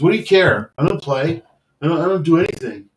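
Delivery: loud, high, bitter outburst; pissed off. Retold or imitated. Benchwarmer tells coach he does nothing, loud angry outburst. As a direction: spit out how useless he feels. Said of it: "he got pissed".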